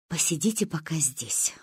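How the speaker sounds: hushed, low whisper. A narrator's reading. Mother leaving the girls in the yard, whispering. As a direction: whisper it to the waiting children. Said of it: "прошептала мама нам".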